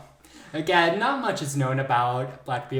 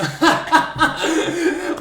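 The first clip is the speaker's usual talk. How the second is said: loud high laugh; burst of laughter; 14 semitones higher, 11 dB louder than usual. Real, not acted. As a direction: crack up loudly. Laughing at the pirate bit.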